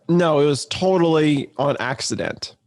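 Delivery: ordinary talk, nothing marked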